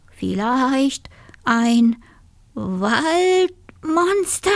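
slow, faltering words climbing high; scared stammer. A narrator's reading. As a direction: stretch each word in rising dread. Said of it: "stotterte der Wolf".

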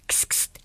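soft unvoiced kst kst; a little voice hissing from outside; whispered kst. A narrator's reading. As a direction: hiss it quietly. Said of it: "ertönte ein Stimmchen".

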